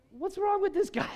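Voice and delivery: mock bewildered. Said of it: "What are you thinking?".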